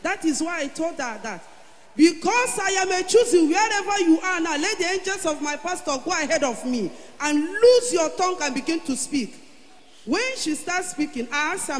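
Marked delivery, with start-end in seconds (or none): 2.0-9.3 loud, high fervent declaration; fervent prayer. Retold or imitated. Commanding her mother to speak again. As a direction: declare it with full force.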